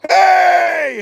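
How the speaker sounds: loud high yell to startle; sudden shout. Retold or imitated. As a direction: bark out a startling hey. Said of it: "and I go".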